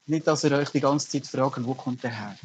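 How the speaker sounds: ordinary talk, nothing marked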